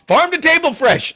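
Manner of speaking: loud, high parody of a sales pitch; mocking ad slogan. Mockingly repeats the sellout's ad slogan.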